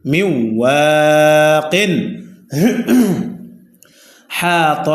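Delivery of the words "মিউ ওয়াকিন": মিউ ওয়াকিন chanted slowly and drawn out; slow recitation. Shown as a demonstration. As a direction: stretch it like tilawat. Recites the word again. (ধীরে টেনে তিলাওয়াত)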